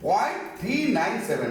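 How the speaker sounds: after "point", "थ्री नाइन सेवन" as separate digits; digit-by-digit decimals. Shown as a demonstration. Teacher repeats the right reading, "थ्री नाइन सेवन" digit by digit. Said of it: "point के बाद digits को pronounce करना चाहिए".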